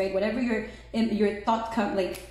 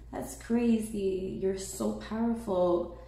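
ordinary talk, nothing marked

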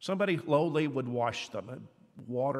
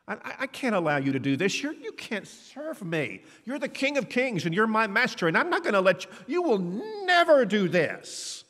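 protesting Peter; 7 semitones higher than usual. Acted. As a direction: beg him not to, building to a near cry on never. Peter voiced protesting humility.

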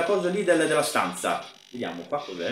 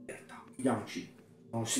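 whisper; 3 semitones lower, 16 dB quieter than usual. Real, not acted. Hiding from monster.

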